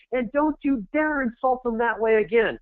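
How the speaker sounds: high, scolding defiant yell; megaphone shout. Acted. Defending the viewer against naysayers, megaphone shout. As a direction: dare the naysayers, shouting them down. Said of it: "I'm your megaphone going".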